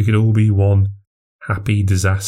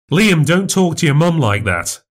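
snapping; 9 semitones higher than usual. Acted. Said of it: "I snapped".

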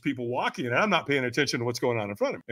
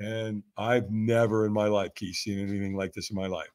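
ordinary talk

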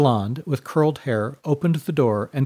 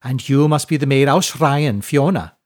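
a warm, louder hostess with rolled R's; shrublander rolling R's; 2 semitones higher, 5 dB louder than usual. A narrator's reading. Widow greeting the laird at the door, with rolled R's. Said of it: "with the rolling R's of a shrublander".

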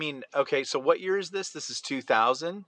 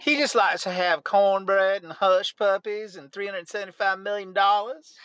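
higher Southern Belle accent, hammed up; mock Southern Belle; 7 semitones higher than usual. Host mocking her donor talk, higher hammed-up Southern Belle accent. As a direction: ham up a high Southern Belle voice. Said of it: "speaking in a fake Southern accent".

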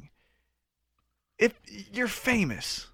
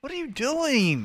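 incredulous; 8 semitones higher, slower than usual. Real, not acted. Incredulous at Sabu news.

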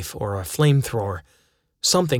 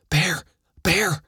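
urgent whispered shout, rising high; hiss-shout a warning in the dark; whisper yelling; 6 semitones higher than usual. Retold or imitated. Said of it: "I whisper screamed".

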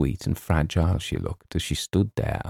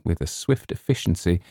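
ordinary delivery, nothing marked

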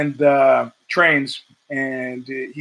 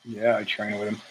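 much softer, reluctant mumble of yes; sheepish admission; 6 semitones lower, 10 dB quieter than usual. Retold or imitated. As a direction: admit it like you'd rather not.

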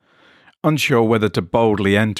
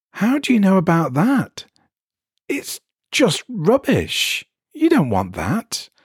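much higher, alarmed and defensive; startled man; 10 semitones higher than usual. Acted. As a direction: caught out, trying to brush it off. Jeff startled by the stranger.

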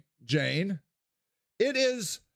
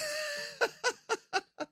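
high burst of laughter; 6 dB quieter than usual. Real, not acted. Laughing at friend's remark.

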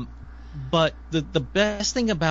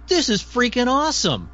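excited; 10 semitones higher than usual. Retold or imitated. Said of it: "Gabrielle goes".

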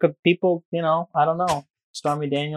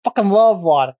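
New York emphasis; 6 semitones higher, 4 dB louder than usual. Real, not acted. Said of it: "Only a New Yorker would say it like that".

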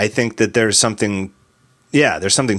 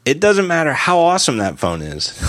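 ordinary talk, nothing marked

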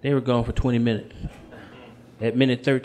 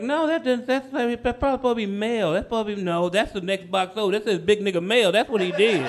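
put-on higher-pitched girlfriend reasoning aloud; acted higher woman's voice; imitated girlfriend; 6 semitones higher than usual. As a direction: play her working it out, pitched up. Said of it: "and she's like".

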